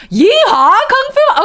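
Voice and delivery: loud, high whoop; excited whoop. Real, not acted. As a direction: cheer it out loud. Excited outburst reading letter.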